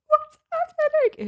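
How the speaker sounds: tiny, very high mimicked voice; mom's bewilderment. Mom backing out during the proposal, high bewildered squeak. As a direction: squeak it in mock confusion. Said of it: "my mom backing out and she's like".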